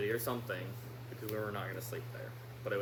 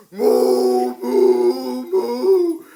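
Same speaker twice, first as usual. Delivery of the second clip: loud cow moos; 3 semitones higher, 21 dB louder, much slower than usual. Retold or imitated. Imitating the cows.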